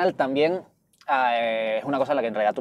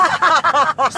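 a loud, high laugh bursting out; real laugh at own joke; high burst of laughter; 14 semitones higher, 7 dB louder than usual. Real, not acted. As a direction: crack up laughing.

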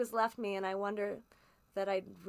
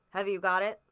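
curt, louder demand; impatient; 7 dB louder than usual. A narrator's reading. Stranger demanding the box from Lanyon, impatient. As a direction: snap the question out, eager to grab it. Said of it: "said the stranger impatiently".

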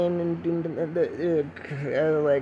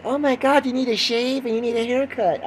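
high-pitched exaggerated dismay; mock appalled; 7 semitones higher than usual. Viewer seeing the unshaven podcaster, mock appalled. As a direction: blurt it like a horrified onlooker.

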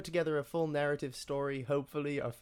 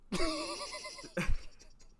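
squealing laugh high above the voice; a real high-pitched laugh; high burst of laughter. Real, not acted. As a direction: break into a shrieking giggle. Laughing at own joke.

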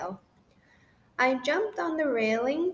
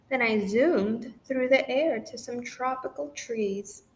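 ordinary delivery

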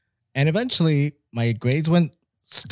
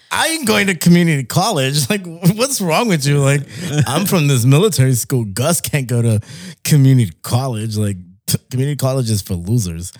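snobbish scoff; 2 semitones higher, 5 dB louder than usual. Retold or imitated. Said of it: "I was like".

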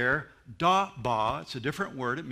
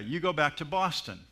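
ordinary talk, nothing marked

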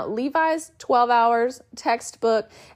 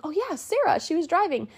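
lightly higher recalling tone; a mild higher aha, remembering; 8 semitones higher than usual. Retold or imitated. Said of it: "And I was like".

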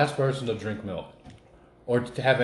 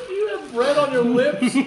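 laughing; 5 dB louder, slower than usual. Real, not acted. Laughing at friend's bread lips.

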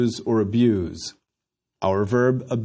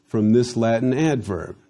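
usual speaking voice

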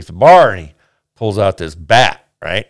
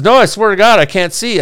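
high, louder acted denial; insistent protest; 10 semitones higher, 3 dB louder than usual. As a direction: swear it earnestly, pitch jumping up. Blind man protesting in the joke.

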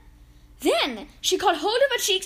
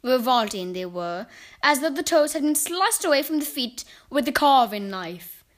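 plain reading voice

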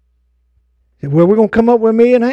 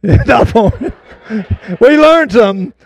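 slow words broken by real laughter; laughing; much slower than usual. Real, not acted. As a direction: laugh through an inside joke. Laughing over kitchen mishap with Ronnie.

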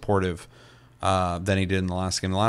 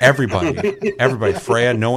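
loud, laughing as it comes out; laughing emphasis; 3 semitones higher, 8 dB louder than usual. Real, not acted. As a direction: exclaim it loudly through laughter. Laughing while telling the reaction.

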